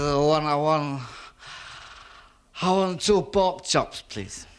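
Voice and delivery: tipsy man asking for chops, slow and slurred; drunken slur. Acted. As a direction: play it sloshed and slow.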